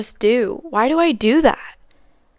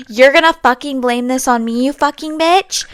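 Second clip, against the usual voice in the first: loud, rising mock yell at the coworker; furious insult; 4 semitones higher, 5 dB louder than usual. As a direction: spit the insult like the enraged lady. Customer swears at coworker.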